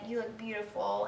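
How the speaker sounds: softly imitating a choked-up father; very quiet, a touch of emotion; choked-up dad. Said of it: "choked up".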